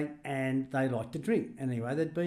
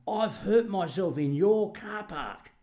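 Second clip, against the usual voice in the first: put-on slow, whingeing old lady, pitched up; whiny Dulcie; 7 semitones higher, 4 dB louder, much slower than usual. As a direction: drag it out like a complaining old woman. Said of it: "she comes down to the club and she said".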